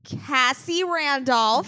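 loud, slow, drawn-out reveal; excited reveal. Real, not acted. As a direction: unveil the name like a prize. Big reveal of guest.

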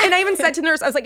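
usual speaking voice

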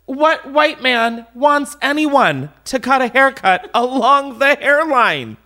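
high, slow, indignant rant; mad. Real, not acted. Customer ranting about a hairdresser's cut, mad. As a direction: hammer each word in high-pitched outrage. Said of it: "oh my God, I'm so mad".

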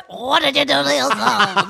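put-on laughing Boston accent. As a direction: laugh through a Boston imitation. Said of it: "such a distinguished accent".

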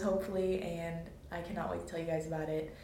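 usual speaking voice

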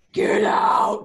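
harsh, unvoiced demonic growl; retold demonic outburst; devil voice. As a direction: imitate a scary devil snapping at a kid. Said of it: "like, devil voice".

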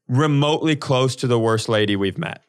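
ordinary delivery

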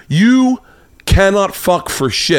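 high, slow, emphatic mock anger; retold angry insult. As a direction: imitate her spitting out an insult word by word. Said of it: "she immediately gets angry".

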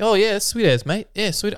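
put-on high, bright Kiwi-accented "sweet as, mate". Local guys greeting a hiker in a Kiwi accent. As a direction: play a New Zealand local in a Kiwi accent. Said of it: "they'd be like".

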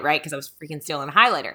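normal talking voice

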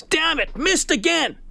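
mock scream. Golfer missing yet another short putt, mock screaming. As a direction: imitate a fed-up golfer's scream without full volume.

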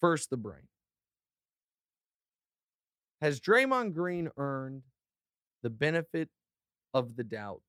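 lightly played courtroom lawyer; mock defense lawyer. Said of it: "time to defend him".

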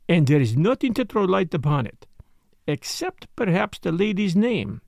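put-on French-accented detective, measured and teasing; Poirot, teasing detective.

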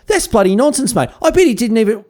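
mock indignant. Mock rebuttal to Darwin's diary.

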